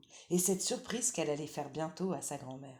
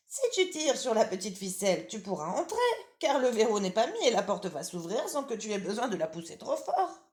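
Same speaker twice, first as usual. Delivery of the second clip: lightly higher, louder granny voice; old grandmother; 5 semitones higher, 7 dB louder than usual. Acted. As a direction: call out as a drowsy old granny from bed. Grandmother explains.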